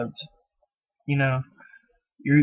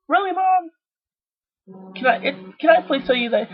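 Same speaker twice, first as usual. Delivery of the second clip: much higher, incredulous squeal; mock surprise. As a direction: squeak it out in disbelief. Mock reply to mom.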